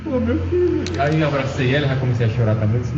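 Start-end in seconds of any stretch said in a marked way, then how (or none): 0.1-0.8 lifted high, aching "meu filho"; a mother moved to tears, voice climbing; mother's voice breaking upward; mother's tearful cry. Retold or imitated.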